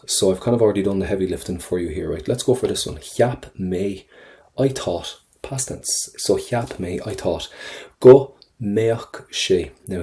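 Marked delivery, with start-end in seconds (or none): none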